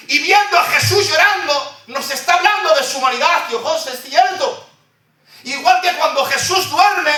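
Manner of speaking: high, ringing preacher's cry, building emphasis; full-force preaching. Acted. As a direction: proclaim it to the congregation. Preacher raises voice at pulpit.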